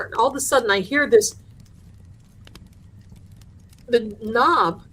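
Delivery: usual speaking voice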